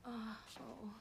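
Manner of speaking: barely audible, unvoiced reply; near-whispered, hesitant assent; awkward murmur. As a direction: answer under the breath. Awkward answer about the bath.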